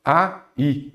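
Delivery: "A, I" as two separate syllables; split into syllables. Shown as a demonstration. Teacher showing students how the word splits, "A, I" syllable by syllable. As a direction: say "A", pause, then "I". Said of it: "vamos separar em sílaba".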